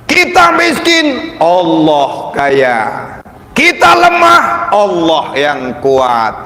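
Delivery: rising high and loud to a shout; fervent preaching. Acted. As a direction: build the sermon to a climax. Preaching at full force.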